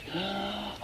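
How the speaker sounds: a soft winded gasp for air; breathless gasp. Acted. Mister Squiggle back from the moon, gasping softly for breath. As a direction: gasp as if the climb took it out of you. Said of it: "that's why I'm out of breath".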